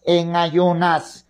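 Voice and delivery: en ayunas stretched slowly, each syllable held; slow emphatic repeat of en ayunas; slow, drawn-out repeat. Shown as a demonstration. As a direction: lean on en ayunas and drag it out.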